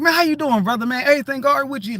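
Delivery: high, lively greeting; animated re-enacted hello; upbeat check-in. Acts out concerned greeting.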